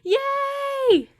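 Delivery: very high, drawn-out squeal; excited cheer. Real, not acted. Streamer celebrating a success in the game, excited cheer.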